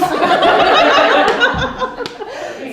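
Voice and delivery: a big, bright burst of real laughter, pitched well up; loud high laugh. Real, not acted. Teacher laughing at her own slip in front of the coach, loud laugh.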